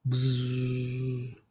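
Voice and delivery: low, quiet held buzz; long imitated buzz; buzzing sound effect. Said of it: "Just sound effect".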